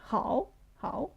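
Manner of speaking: "how" soft, held low with a turn; low third tone. Shown as a demonstration. Teacher showing the low tone, third tone. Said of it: "it's a low tone actually".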